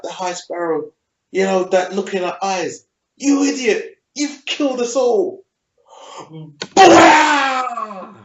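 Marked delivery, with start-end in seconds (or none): 3.2-5.4 high-pitched, indignant retelling; mock outraged accusation. Voices Margaery's outburst at the Sparrow. 6.7-8.1 long, loud, high mouth-made blast; explosion noise. Retold or imitated. Imitates the wildfire blast.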